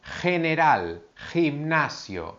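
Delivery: "general" and "gimnasio" with a strong throaty g; strong g demo. Shown as a demonstration. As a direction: push the harsh j sound from the throat. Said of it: "es una G más fuerte".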